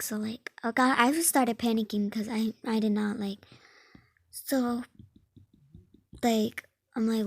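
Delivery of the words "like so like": ordinary talk, nothing marked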